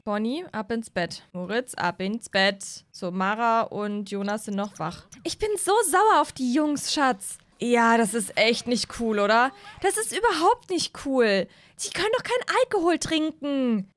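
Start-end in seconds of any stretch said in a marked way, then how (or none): 5.2-13.9 higher, indignant mom to her husband; higher indignant mother complaining to husband; upset mom. Acted. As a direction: vent to your partner about the kids.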